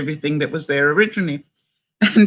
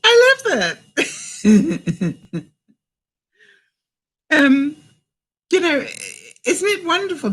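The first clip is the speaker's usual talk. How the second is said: tickled delight; 9 semitones higher than usual. Real, not acted. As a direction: burst out delighted, fresh from a big laugh. Laughing hard at the saying.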